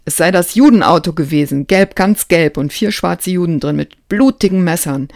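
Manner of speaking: put-on a boy bellowing in panic, fast and loud; roaring. A narrator's reading. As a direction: roar it out, words tumbling. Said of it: "eine Stimme aus mir herausbrüllte".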